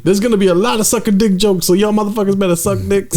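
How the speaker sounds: high-pitched mock hype-comic bullying; vicious host. Riffing as the showcase host warning the crowd, high and mock vicious. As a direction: play the amped-up host threatening the room.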